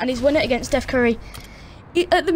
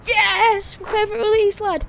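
excited yes; 7 semitones higher than usual. Real, not acted. Wins the game point.

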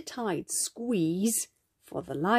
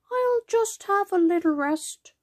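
high-pitched, lightly yawned line; a mild storybook yawn from a tired grandma; 11 semitones higher than usual. A narrator's reading. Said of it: "she yawned".

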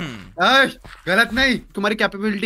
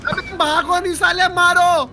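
raised, high urgent order; urgent yelling; 6 semitones higher, 4 dB louder, slower than usual. Pretend. Player telling his friend to fight back, urgent yelling. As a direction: shout the command over the panic.

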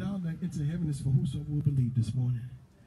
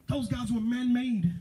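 ordinary talk

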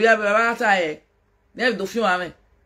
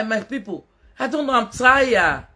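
ordinary talk

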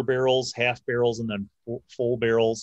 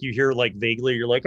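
ordinary talk, nothing marked

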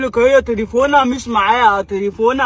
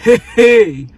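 louder giggly hee-hee like a woman; womanly laugh; 7 dB louder, slower than usual. Shown as a demonstration. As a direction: show her how a lady laughs. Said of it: "بضحكة صوت الستات". (ضحكة ستات عالية هي هي)

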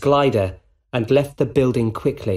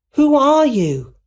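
high, loud, drawn-out call; 5 dB louder than usual. A narrator's reading. MJ calls to the masked rescuer, calling out. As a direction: call it slowly across a distance, high. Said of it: "she called".

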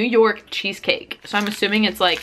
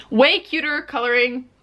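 usual speaking voice

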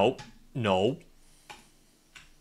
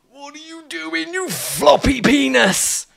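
high, sing-song wail dragged out slow; exasperated squeal; 12 semitones higher, 5 dB louder, slower than usual. Real, not acted. Gamer scolding his falling character, high sing-song wail. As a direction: wail the insult like a half-sung lament.

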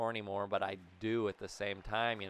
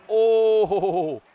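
surprised shout; 8 semitones higher, 13 dB louder than usual. Real, not acted. Sudden loud exclamation in play.

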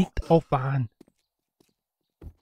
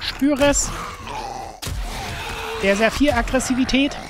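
hammed-up rage; 3 semitones higher than usual. Gamer fakes a fit of aggression at the enemy, hammed up. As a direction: play at boiling over with anger.

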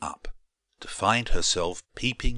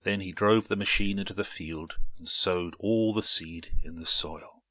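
usual speaking voice

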